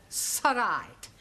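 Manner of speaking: "sorright" slurred as one word; imitating sorright. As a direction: say "sorright" run together like the student. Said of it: "no such word in the English language as".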